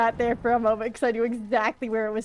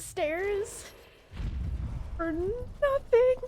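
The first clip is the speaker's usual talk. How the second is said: high, very slow whine on nothing; whiny drawn-out wail; 10 semitones higher, much slower than usual. Acted. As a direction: stretch it out in a rising whine. Drawn-out repeat of complaint.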